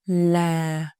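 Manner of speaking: LA drawn out low, sinking; falling tone. Shown as a demonstration. Teacher gives thanh huyền example, LA low and sinking. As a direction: let LA slide down and stay there. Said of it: "down and stay low".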